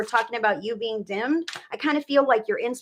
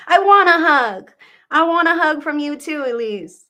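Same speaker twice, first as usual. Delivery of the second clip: put-on high, loud, pleading child's voice; a small daughter reaching for a hug; little girl asking; 5 semitones higher, 8 dB louder than usual. Spirit daughter asking her mother for a hug, high and loud.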